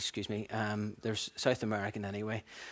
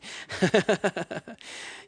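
a high real giggle; 8 semitones higher than usual. Real, not acted. Preacher enjoying his own script, high giggle. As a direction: giggle at yourself.

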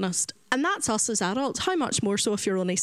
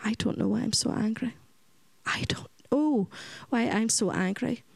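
put-on small hushed whisper of a troubled child; a child's lost whisper. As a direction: breathe it out, barely voiced. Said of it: "He said".

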